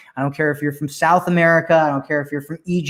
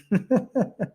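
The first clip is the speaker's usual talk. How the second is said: amused laugh; 6 semitones higher, 6 dB quieter than usual. Real, not acted. Laughs at the question.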